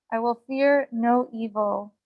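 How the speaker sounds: ordinary delivery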